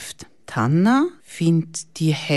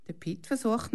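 usual speaking voice

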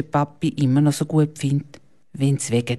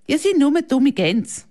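higher, indignant huff; huffy snort; 10 semitones higher than usual. A narrator's reading. Milan schnaubt die Mädchen empört an. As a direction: snort it out in offended anger. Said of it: "schnaubt jetzt der Milan".

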